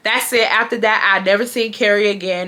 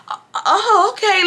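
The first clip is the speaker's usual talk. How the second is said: put-on startled, much higher, hesitant sales boy; hesitant high oh okay; 12 semitones higher than usual. Shop worker startled by the threat, hesitant and high. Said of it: "Like, he hesitated".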